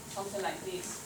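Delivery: ordinary talk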